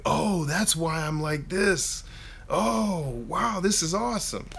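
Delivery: high, slow mock wonder; amazed discovery. Family member discovering personality type, mock amazement. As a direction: draw it out like a revelation.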